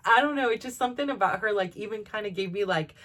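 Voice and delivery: normal talking voice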